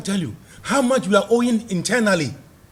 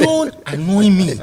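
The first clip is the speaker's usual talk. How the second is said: mock annoyance; 6 dB louder, slower than usual. Real, not acted. Repeats rebuke, louder and slower.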